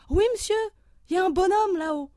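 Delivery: put-on high, loud child's answer; eager schoolboy. Pupil answering the professor, high and loud. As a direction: blurt it out like a keen boy. Pupil points at the paper man.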